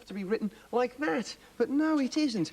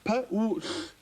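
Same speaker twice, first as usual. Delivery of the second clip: ordinary delivery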